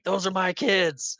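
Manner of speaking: retold protest. Peter seeing his kids in a net, mock outcry. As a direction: cry out slowly as an imitated outraged father.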